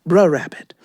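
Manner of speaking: "bruh" cut short, no r; "bruh rabbit". Shown as a demonstration. Teaching the audience, clipped "bruh rabbit". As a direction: clip "bruh" before "rabbit". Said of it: "It almost sounds like".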